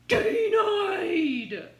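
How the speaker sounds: loud, high game-show "Denied!"; mock rejection shout. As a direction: bellow it like a buzzer verdict. Fourth failure, mock rejection.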